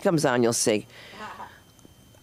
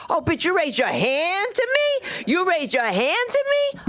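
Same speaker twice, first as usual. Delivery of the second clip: hammed-up incredulous fury, pitch swooping high; outraged mother; 11 semitones higher than usual. Mother catching child's raised hand before a whooping, mock outraged. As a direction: challenge them, voice climbing sharply on the question.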